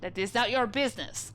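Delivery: put-on proud, broken English with a Russian accent; Russian sailor accent. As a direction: announce your name like a stern Russian crewman. Sailor stonewalls.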